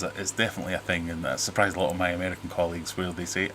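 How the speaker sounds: ordinary talk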